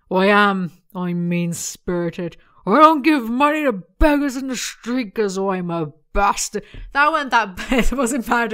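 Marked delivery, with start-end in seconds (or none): 0.1-6.6 loud put-on smug voice; mock Topher voice. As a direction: overplay the proud bastard. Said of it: "I can't do an accent".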